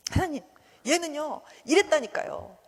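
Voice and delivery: high, insistent complaint; tattling complaint. Acted. Jonah reporting his enemies to God, insistently complaining. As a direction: tell on someone, pleading your case. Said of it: "막 요나가 그래요".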